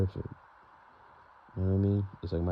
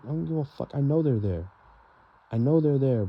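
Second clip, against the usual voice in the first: louder, pitched-up fed-up snap; exasperated outburst; 6 semitones higher, 5 dB louder than usual. Retold or imitated. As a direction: cut him off, sick of being checked on. Retold outburst at grandfather.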